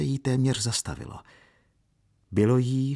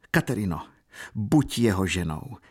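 ordinary delivery, nothing marked

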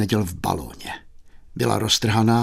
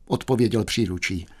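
usual speaking voice

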